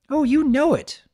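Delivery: high, loud breezy voice; cheerful fib. A narrator's reading. Bon Bon breezily claims she hurried for Lyra. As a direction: answer too brightly. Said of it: "Bon Bon smiled".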